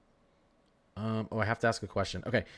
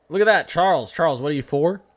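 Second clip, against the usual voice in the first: high, loud, bouncy teasing; high excited teasing of Charles; playful teasing; 5 semitones higher, 8 dB louder than usual. Real, not acted. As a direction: rib the viewer playfully. Teasing a young viewer.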